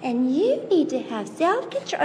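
lightly voiced prim child scolding; a hint of a know-it-all sister; mock prim child; imitating bossy little girl. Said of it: "the sister goes".

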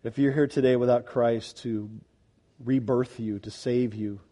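low, soft altar-call appeal; earnest pleading. Real, not acted. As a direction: plead gently and gravely, pausing between appeals. I'm begging you.

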